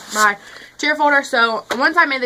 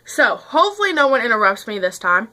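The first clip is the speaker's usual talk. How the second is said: ordinary delivery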